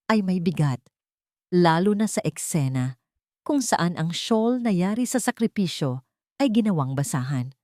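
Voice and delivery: plain reading voice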